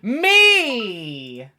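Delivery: loud high thrilled cry; excited. Real, not acted. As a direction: burst it out with delight. Announcing own pairing.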